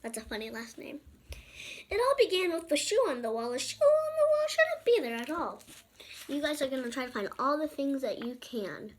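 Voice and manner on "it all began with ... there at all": high, loud sing-song read; rising sing-song storybook delivery. A narrator's reading. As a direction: lift it up like reading to kids. Reading opening lines in sing-song.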